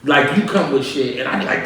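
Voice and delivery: usual speaking voice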